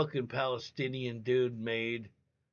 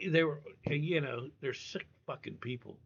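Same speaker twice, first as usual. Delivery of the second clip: ordinary talk, nothing marked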